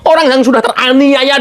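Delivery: ordinary talk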